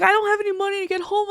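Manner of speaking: high, rushed whiny plea; mock crying. Ex begging for money to get home, mock whining. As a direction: mimic his pleading fast and high. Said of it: "'cause he's crying".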